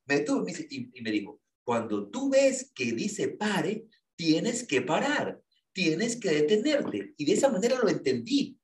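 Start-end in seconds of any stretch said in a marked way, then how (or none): 1.6-7.1 very slow, higher-pitched instruction; slow, deliberate imitated admonition; emphatic lecturing. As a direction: spell out the rule, retelling a stern lesson. Friend's retold lesson after shouting.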